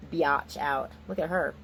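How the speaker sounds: usual speaking voice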